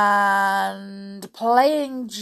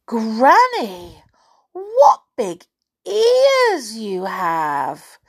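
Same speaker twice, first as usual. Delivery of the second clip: high, slow, loud wonder; wide-eyed storybook child, drawn-out; amazed little girl; 5 semitones higher, 8 dB louder, much slower than usual. Acted. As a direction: marvel with long stretched words. Girl marvels at granny.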